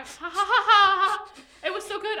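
big loud laugh bursting through the words; helpless laughter. Real, not acted. Cracking up at her cohost's joke, loud laughing. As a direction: laugh hard, then squeeze out the words still laughing.

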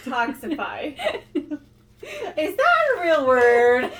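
loud, high words broken by laughter; laughing. Real, not acted. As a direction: crack up over a made-up word. Laughing at made-up word.

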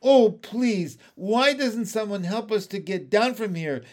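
high, pleading call for help. A narrator's reading. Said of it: "Miss Spider called out".

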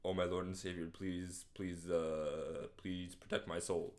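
low, soft, halting plea; mock last prayer. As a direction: pray quietly as doom arrives. Doomed man's last prayer, acted.

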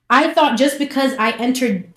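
usual speaking voice

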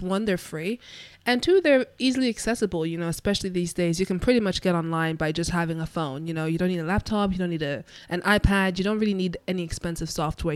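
ordinary talk, nothing marked

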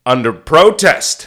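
loud, slow declaration of protest; indignant Orson Welles. Retold or imitated. Orson giving in to one director, loudly indignant. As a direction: proclaim it with wounded grandeur. Acted Orson grudgingly yields.

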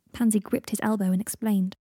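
ordinary delivery

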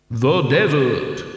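very slow, echoing answer; voice of Death. A narrator's reading. Death tells the dead man where they are, solemn. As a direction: intone it solemnly, from beyond the grave.

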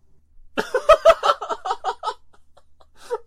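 a high, loud, breathy burst of laughter. Real, not acted. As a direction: crack up hard at the joke. Caught repeating Korn joke.

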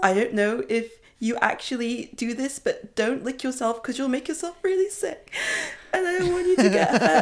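sobbing with relief. Acted. Pepper cleaning revived Clove while crying, hammed-up sobs of relief. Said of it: "bursts into, like, noisy, gulping sobs of relief".

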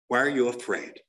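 low, pointed question; grave challenge. Real, not acted. Speaker challenging those who won't answer questions, grave challenge. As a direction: press them with a low-pitched demand.

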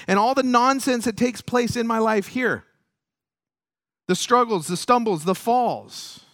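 loud, high, driving sermon delivery; impassioned preaching. Acted. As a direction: preach it with force and rising conviction. Preaches at full force.

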